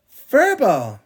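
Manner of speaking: put-on high, very loud toddler shout; gleeful shout. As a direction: shout it out giggling. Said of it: "shouted Honey, laughing".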